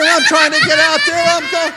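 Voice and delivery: ordinary delivery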